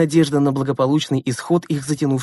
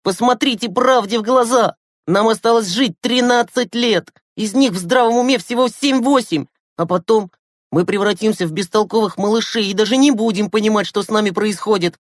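high, heated retort; losing his temper; 8 semitones higher, 4 dB louder than usual. A narrator's reading. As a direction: snap back in exasperation, voice raised in pitch. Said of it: "вышел из себя Глеб". (вспылив, на повышенных тонах)

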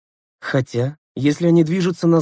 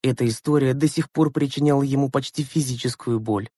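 ordinary delivery, nothing marked

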